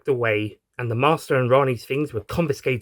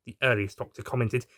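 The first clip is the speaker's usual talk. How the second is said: plain reading voice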